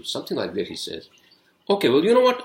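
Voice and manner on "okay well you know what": loud, high-pitched retold comeback; fed up. Driver about to call the cops on the other man, fed up. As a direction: cut the argument off, voice raised.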